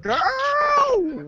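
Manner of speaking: put-on a high squealing yelp of "Girl"; surprised shout. Sudden outburst mid-conversation.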